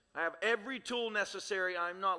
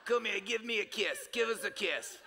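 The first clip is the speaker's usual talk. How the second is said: Bronx lady voice. Retold or imitated. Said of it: "from Bronx or something like that".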